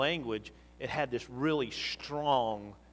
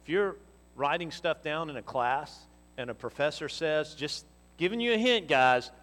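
normal talking voice

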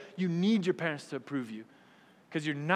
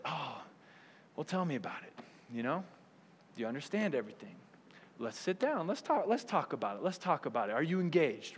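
softer, patient parent voice; a soft, kind parent going over grades; gentle parent; 3 semitones lower, 6 dB quieter than usual. Retold or imitated. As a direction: calmly invite the child to talk. Gentle parent reviewing report card.

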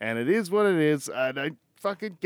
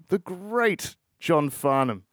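ordinary talk, nothing marked

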